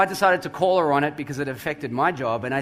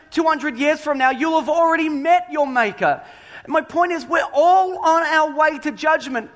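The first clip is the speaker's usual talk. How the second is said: preaching at full force; 8 semitones higher than usual. Acted. Pastor urging his church about judgment, preaching hard.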